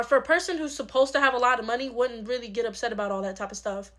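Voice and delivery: usual speaking voice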